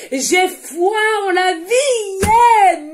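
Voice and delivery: loud, high, slow exalted cry; ecstatic shout. Real, not acted. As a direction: burst out with joy. Exalted affirmation outburst.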